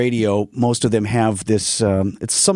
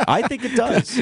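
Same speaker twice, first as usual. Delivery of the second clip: laughing; 10 semitones higher, slower than usual. Real, not acted. Laughing at own soundproofing hope.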